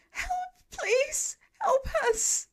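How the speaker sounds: very high, loud cry for help; terrified scream. A narrator's reading. Trapped woman screaming for help from the fire, terrified. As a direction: scream in terror from a burning window. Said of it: "The woman's voice exudes terror as she screams again".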